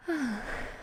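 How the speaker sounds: soft, breath-filled "ah" sigh; sighed reaction. Shown as a demonstration. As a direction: sigh in reply to the news.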